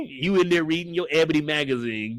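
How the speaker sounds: ordinary talk, nothing marked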